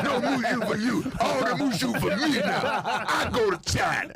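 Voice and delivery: slow, high teasing through laughter; laughing tease. Real, not acted. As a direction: gloat jokingly while laughing. Joking mock boast.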